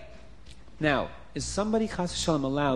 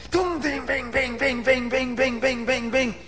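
rapid high-pitched volley of bangs; imitated beating; 10 semitones higher than usual. A narrator's reading. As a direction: rattle off stick blows as sound effects.

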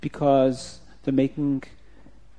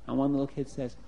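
usual speaking voice

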